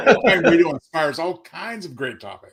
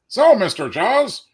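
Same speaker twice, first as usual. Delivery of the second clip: mock interviewer; 4 semitones higher than usual. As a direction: play the cheesy radio interviewer. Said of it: "the Jaws version of the [name] work".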